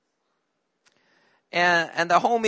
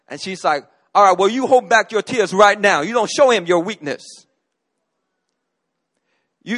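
louder, firm scolding teacher voice; stern teacher; 3 semitones higher, 6 dB louder than usual. Retold or imitated. Teacher telling crying boy to hold back tears, loud and firm. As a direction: lay down the law to the boy. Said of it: "she said".

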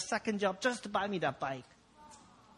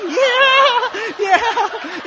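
high, loud drawn-out whoop; acted cocky cheer; 14 semitones higher, 12 dB louder than usual. Boy shows off for the girls, loud mock whoop. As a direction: whoop it in hammed-up bravado. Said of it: "And I'm like".

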